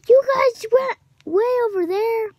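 high, loud disbelieving question; incredulous complaint. Real, not acted. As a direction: call out teammates in disbelief. Complains teammates landed far away.

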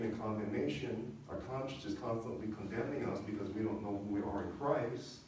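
usual speaking voice

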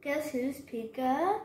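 ordinary delivery, nothing marked

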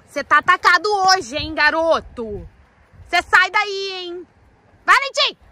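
high, loud telling-off; scolding yell. Real, not acted. Owner shouting at the misbehaving colt. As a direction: bark the order then shout his name.

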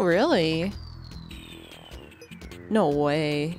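ordinary delivery

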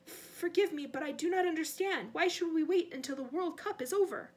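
nervous, deferential pleading; timid servant. Acted. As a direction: question your master meekly. Wormtail questions his master.

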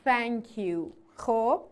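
usual speaking voice

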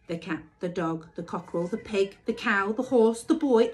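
ordinary delivery

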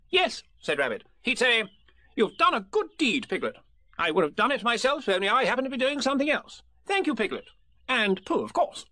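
high, bossy little rabbit voice; fussy Rabbit. Acted. Rabbit pressing Piglet to agree, bossy and high. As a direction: lay out the plan like a busy organiser.

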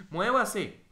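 high, loud impatient shout; grito impaciente. Real, not acted. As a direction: shout it as an urgent order. Impatient order during the game. (grito de impaciencia)

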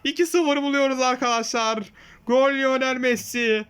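screaming football commentator. Acted. Takes over commentary, goal scored.

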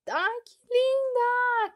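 high, loud, drawn-out squeal of delight. Real, not acted. Unboxing a cute pen for viewers, a drawn-out high squeal. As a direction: gush over it, stretching linda. Gushing over a new pen.

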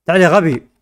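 loud call to a pet. Real, not acted. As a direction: shout for the animal to come. Calling pet in game.

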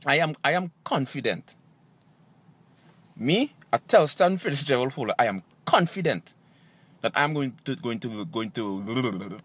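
very low voice, stumbling at the end; deep and soft, tripping into repeats; self-assured declaration. Acted. Comedian insisting he is confident, deep voice losing its thread.

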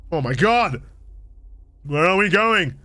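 ordinary talk

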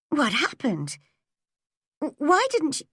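high, hesitant question with a stammered start; eagerly curious. Acted. Anne asking Marilla about her and John, eagerly curious.

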